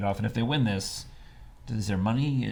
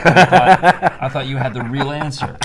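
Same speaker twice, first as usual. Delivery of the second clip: bursting out laughing, much higher and louder; 5 semitones higher, 13 dB louder than usual. Real, not acted. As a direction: laugh through the words. Laughing at Hoosier joke.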